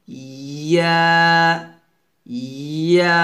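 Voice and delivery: ইয়া with a long sliding ee into aa; drawn-out YA. Shown as a demonstration. Teacher says it for students to copy, drawn-out ইয়া. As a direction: hold the start of ইয়া, then open to aa. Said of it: "এভাবে".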